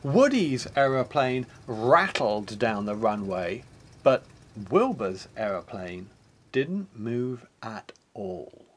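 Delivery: ordinary delivery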